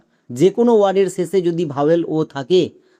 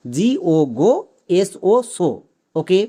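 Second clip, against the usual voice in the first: ordinary talk, nothing marked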